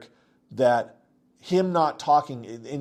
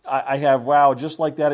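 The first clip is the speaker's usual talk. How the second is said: ordinary talk, nothing marked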